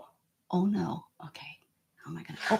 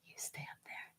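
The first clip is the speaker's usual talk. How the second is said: will it to stay put under your breath; hushed plea to the shelf; whisper; 31 dB quieter, faster than usual. Real, not acted. Coaxing a teetering object.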